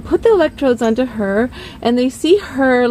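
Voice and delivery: ordinary talk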